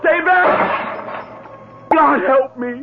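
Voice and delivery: panicked cries of stay back, then a high, breathless plea; a man backing away from a ghost in terror; high, frightened pleading; terrified. Acted. Franz facing the ghost.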